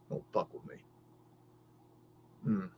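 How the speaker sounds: breathed, voiceless threat; menacing whisper. Acted. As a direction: hiss it close like a secret warning.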